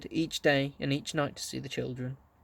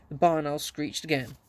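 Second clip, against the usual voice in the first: ordinary delivery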